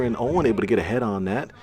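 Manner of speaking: ordinary talk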